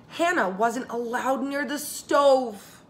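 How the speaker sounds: usual speaking voice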